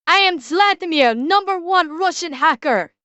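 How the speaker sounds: hammed-up Russian hacker accent; bad Russian accent. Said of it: "affect a bad Russian accent".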